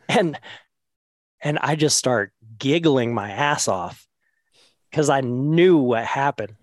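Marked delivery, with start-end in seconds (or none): none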